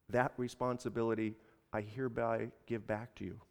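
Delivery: soft, measured declaration with long pauses; hushed, low, solemn resolve; very careful. A narrator's reading. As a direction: weigh each phrase quietly and deliberately. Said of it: "he said very carefully".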